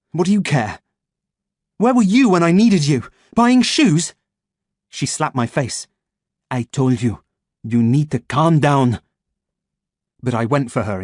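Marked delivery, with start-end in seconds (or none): 0.1-4.1 angry outburst. Acted. 6.5-9.0 firm warning. Acted.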